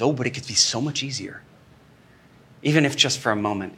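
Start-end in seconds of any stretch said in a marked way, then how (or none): 0.0-1.4 tempting drug voice. Acted. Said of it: "the drug comes in and says".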